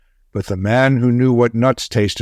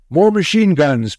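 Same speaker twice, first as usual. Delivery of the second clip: mild yell; 6 semitones higher than usual. A narrator's reading. Bill to Tom in the trench, yelling. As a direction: call out urgently for more guns. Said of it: "Bill yelled to Tom".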